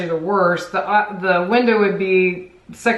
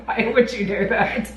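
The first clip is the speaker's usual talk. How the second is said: laughing; 4 semitones higher than usual. Real, not acted. Laughing at passport question.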